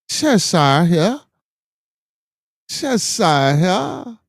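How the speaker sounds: high, slow, hammed-up English accent. Shown as a demonstration. Showing off his English to the chat, exaggerated English accent. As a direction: draw it out in a mock English accent. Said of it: "my English".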